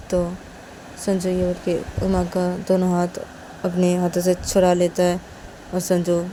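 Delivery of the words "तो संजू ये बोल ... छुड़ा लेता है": plain reading voice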